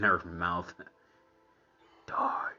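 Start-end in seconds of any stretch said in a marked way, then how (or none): 2.1-2.5 whispery mock-sinister chant; eerie whispered imitation. Viewer mimicking the evil house's command, eerie whisper. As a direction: whisper it like a haunting voice.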